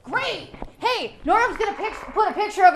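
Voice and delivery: put-on high, bright greeting to the kids; kids show host. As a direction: call out to the boys and girls like a kids' TV host.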